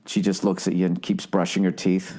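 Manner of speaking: usual speaking voice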